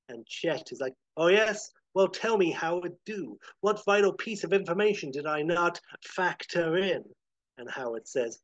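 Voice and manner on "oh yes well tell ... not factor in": raised, loud mocking challenge; taunting villain. Chet challenges Howard over his long game, taunting. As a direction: play the villain daring his foe to explain.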